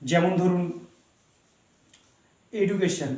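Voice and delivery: normal talking voice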